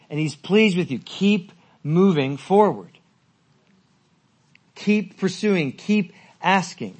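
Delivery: slow, loud, emphatic preaching; preacher rallies his church, slow and loud; preaching at full force. Acted.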